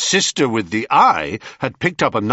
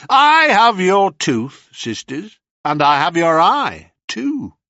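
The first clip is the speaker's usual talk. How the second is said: taunting hero; 6 semitones higher, 4 dB louder than usual. Acted. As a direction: savour the upper hand.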